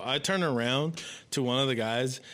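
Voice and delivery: ordinary talk, nothing marked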